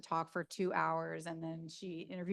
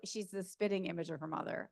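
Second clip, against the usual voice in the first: ordinary talk, nothing marked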